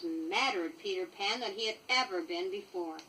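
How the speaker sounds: ordinary delivery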